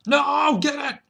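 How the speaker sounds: high, loud urging yell; real excitement yelling at the screen; excited shout. Real, not acted. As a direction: cheer the shot on, loud and high. Urging a shot at buffalo.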